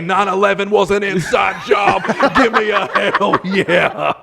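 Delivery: hyped-up wrestling promo shout; wrestler promo. Retold or imitated. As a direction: play to the crowd like a heel wrestler. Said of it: "I was gonna look at everybody and say".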